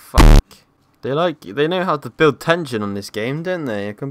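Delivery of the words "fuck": startled curse. Real, not acted. Jump scare in game.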